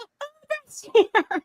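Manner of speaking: very high, slow, stretched call across the cafe; imitated yelled call. Girlfriend calling to Eliot across the cafe, high drawn-out yell. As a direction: mimic a girlfriend calling out that the ride has arrived. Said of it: "she's, like, yelling it".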